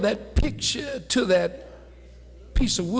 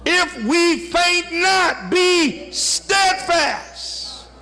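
high, forceful pulpit cry; full-force preaching; 9 semitones higher than usual. Real, not acted. Preacher urging congregation to wait on God, full-force preaching. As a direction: drive it home high and emphatic.

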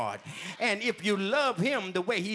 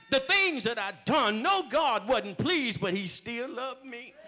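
higher, shouted testimony; shouted preaching; 6 semitones higher than usual. Acted. Preacher testifying to the congregation, shouting. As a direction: cry it out to the church.

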